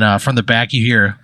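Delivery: usual speaking voice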